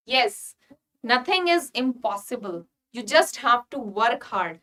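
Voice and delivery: ordinary delivery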